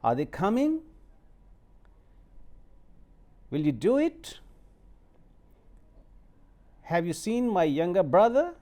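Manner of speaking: yes-no questions ending on a rising tune. Shown as a demonstration. Teacher demonstrating yes-no questions with rising tone. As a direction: lift each question up at the end. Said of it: "you are going on rising tone".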